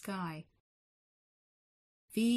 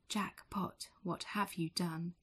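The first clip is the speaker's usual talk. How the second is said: plain reading voice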